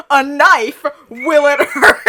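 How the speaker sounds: hammed-up villain announcing a game; menacing announcement. A narrator's reading. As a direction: blurt it loudly, breaking into laughter. Link announces stabbing game.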